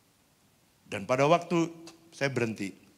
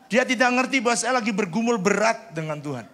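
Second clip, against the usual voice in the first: laughing; 13 semitones higher, 11 dB louder than usual. Retold or imitated. Laughs about the misunderstanding.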